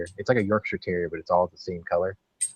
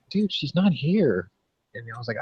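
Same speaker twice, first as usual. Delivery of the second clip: feigned innocence; 9 semitones higher than usual. Retold or imitated. Retelling his lie to the girlfriend's dad, high innocent protest. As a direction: act out playing dumb to an angry dad.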